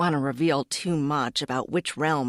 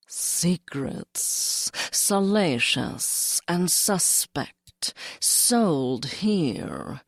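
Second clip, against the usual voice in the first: mysterious whisper; much slower than usual. Acted. Mysterious seller calling from tent.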